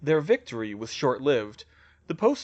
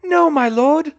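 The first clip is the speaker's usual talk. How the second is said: high, very loud protest; desperate high shout; crying out; 14 semitones higher, 12 dB louder than usual. A narrator's reading. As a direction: cry out to stop your lord. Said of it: "Bellatrix cried".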